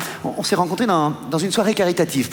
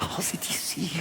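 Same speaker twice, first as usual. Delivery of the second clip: hushed whisper at the door; 13 dB quieter than usual. Acted. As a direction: breathe it out unvoiced, sneaking up. Arriving at her door secretly.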